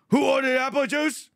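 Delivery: high, loud mock waiter voice; Lenny. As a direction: call it out as a caricatured waiter. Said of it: "He goes".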